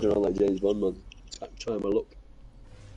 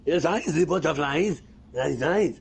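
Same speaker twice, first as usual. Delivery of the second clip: put-on slow, higher, loud German accent; 6 semitones higher, 3 dB louder, slower than usual. Mocking a German girl talking about butterflies, German accent. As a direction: bark it out in a stiff German accent. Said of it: "on the German accent with a girl".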